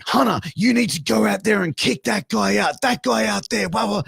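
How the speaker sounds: high, hammed-up complaint to the boss; high-pitched tattling rant; mock indignant demand. Retold backstage complaint to owner.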